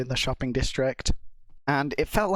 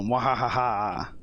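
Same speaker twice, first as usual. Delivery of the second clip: mad scientist laugh; 3 semitones lower, slower than usual. Pretend. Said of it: "We're so evil".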